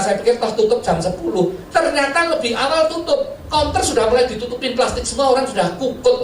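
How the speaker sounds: normal talking voice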